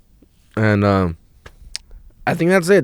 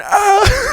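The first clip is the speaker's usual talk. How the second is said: pained yelp. Retold or imitated. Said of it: "So I'm going".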